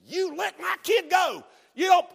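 high, loud protective shout; acted outraged shout; angry father. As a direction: yell like a dad defending his child.

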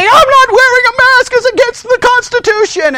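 high-pitched, loud protest; yelling and screaming. Retold or imitated. Man drug out in handcuffs, yelling and screaming. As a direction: imitate a man hollering as he's dragged off. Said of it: "yelling and screaming".

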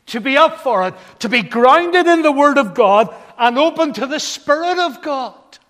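loud, high-pitched rousing exhortation; preaching at full force. Real, not acted. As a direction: urge the congregation on at full voice. Exhorts the congregation.

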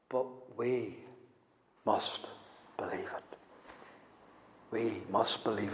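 whispered "believe it"; whispered conviction from the pulpit. Real, not acted. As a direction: breathe it like a secret. Preacher drops voice for effect.

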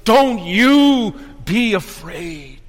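slow, with "you" held and punched; stress on YOU. Acted. God himself to believers this morning, stress on "you". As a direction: draw out "you" in "Don't you be afraid". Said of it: "all the emphasis is on that word ye".

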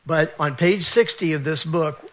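ordinary talk

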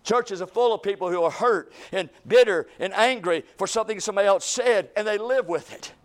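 loud, driving preaching. Acted. Pastor praying for revival over the church, fervent preaching. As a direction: hammer each line home to the church.

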